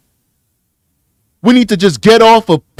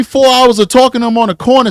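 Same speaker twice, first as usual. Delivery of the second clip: ordinary delivery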